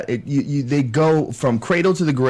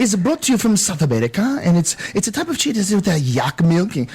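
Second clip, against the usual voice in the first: mock waiter; 5 semitones higher than usual. Mocking a fussy waiter.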